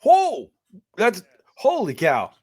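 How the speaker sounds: a real burst of amazement; surprised. Real, not acted. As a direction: react like you can't believe it. Hearing he has four boys.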